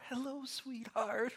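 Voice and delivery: high, slow, sugary mock greeting; lightly voiced doting parent; sweet parent voice. Parent mocking the earlier sweet wake-up, slow and high. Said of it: "No more".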